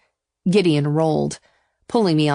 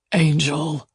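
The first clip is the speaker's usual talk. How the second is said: growled endearment. A narrator's reading. Lover hauls her from the sea, lightly growling. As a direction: growl it with restraint. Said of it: "Gideon growled".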